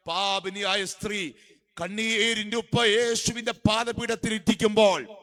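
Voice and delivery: high, loud revival preacher; ringing, high-pitched exhortation; full-force preaching. Real, not acted. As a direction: proclaim it to the whole congregation.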